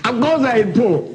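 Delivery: normal talking voice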